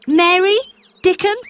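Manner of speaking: high, rising calls to friends; calling out. Acted. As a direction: call both names with delight. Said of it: "he cried".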